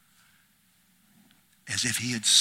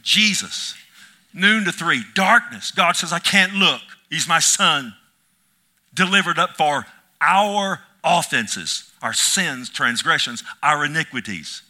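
loud, high preacher's cry; impassioned pulpit preaching; preaching at full force; 4 semitones higher, 9 dB louder than usual. Acted. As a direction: cry it out to the church.

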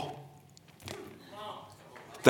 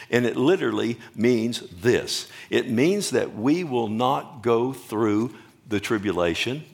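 usual speaking voice

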